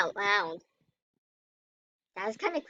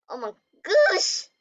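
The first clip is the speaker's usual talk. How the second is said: shocked gasp; 7 semitones higher, 4 dB louder than usual. Real, not acted. Shocked in game.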